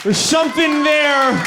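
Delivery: high, slow shouted point. Acted. Comic shouting at the audience, high and slow. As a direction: hammer it home at the crowd.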